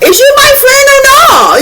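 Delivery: high, loud shriek of disbelief; shrieking outrage. Retold or imitated. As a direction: screech it out, betrayed. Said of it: "I'm like".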